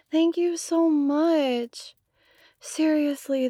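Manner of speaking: high, slow, gushing with emotion; overwhelmed. Acted. Thanking best friend for the necklace, gushing high and slow. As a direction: savor each word, moved and delighted.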